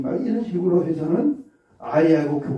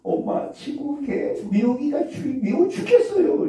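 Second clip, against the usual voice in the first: put-on whiny child; 9 semitones higher than usual. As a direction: play a child tattling to mom. Teacher voices child complaining. (엄마에게 투정하는 아이 목소리)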